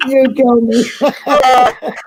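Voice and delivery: can barely get it out for laughing. Real, not acted. Amused co-host laughing at the host's joke.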